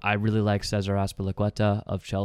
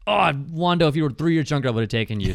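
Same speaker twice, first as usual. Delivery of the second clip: higher-pitched playful lament to a player; mock regret; 6 semitones higher, faster than usual. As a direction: plead teasingly with the absent player, pitch raised then settling. Mock lament addressed to absent player.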